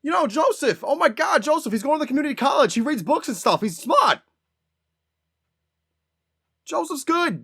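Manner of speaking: high-pitched, loud Boston relative bragging; comedic Boston accent. Acted. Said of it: "there's always gonna be that one guy".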